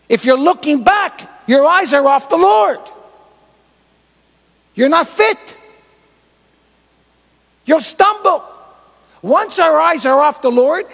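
loud, high-pitched preacher's warning; full-force preaching. Real, not acted. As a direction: shout the warning to the congregation.

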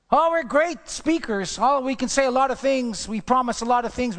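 high, loud pompous boast; mock boasting. Preacher mocking boastful talkers, high pompous voice. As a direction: puff up like a big talker.

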